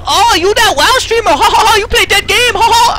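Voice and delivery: high, laughing, broken-English fan impression; mocking fan voice. Mocking the fan who forgot him, high with a put-on laugh. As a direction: cackle it out like a gushing fan. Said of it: "She goes".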